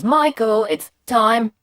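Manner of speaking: same firm call with a hole in it; mum's call with gap. Acted. What the boy hears of mum's call, clipped short. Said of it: "all I could hear was".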